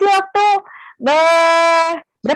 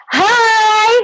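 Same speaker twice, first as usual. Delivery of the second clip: put-on rally the kids with a lifted hi; high, eager call-out; spirited greeting; 8 semitones higher than usual. Shown as a demonstration. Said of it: "mau dengar dulu suaranya, semangatnya".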